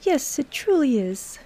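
put-on high, slow English accent; a refined English accent. A narrator's reading. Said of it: "with an English accent".

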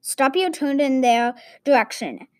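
plain reading voice

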